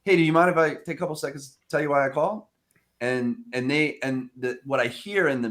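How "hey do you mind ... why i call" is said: cold call opener. Shown as a demonstration. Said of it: "the thing I use now is".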